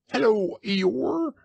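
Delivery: excited little kangaroo; squeaky Roo. Acted. Roo greets Eeyore.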